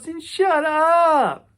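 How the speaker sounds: very high, loud, slow put-on bark; hammed-up announcer shout; mock announcer yell. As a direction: shut the caller down hard. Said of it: "he goes".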